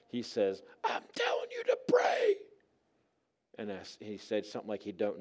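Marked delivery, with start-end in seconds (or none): 0.8-2.4 insistent, harsh whispered croak; forceful raspy whisper; sick man's rasp. Acted. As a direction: press the demand with no voice. Mute man insists again.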